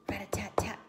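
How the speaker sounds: voiceless tapping sound effect; whispered tapping. Acted. Unknown noise interrupting the animals, whispered tapping. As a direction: tap it out in a whisper.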